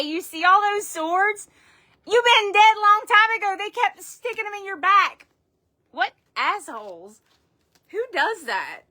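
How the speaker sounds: hammed-up shock at the swords; mock horror at the stabbing; dramatic exclaim.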